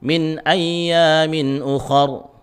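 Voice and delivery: "ukhar" with a dark, o-coloured "kha"; thick kha. Shown as a demonstration. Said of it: "harus tebal".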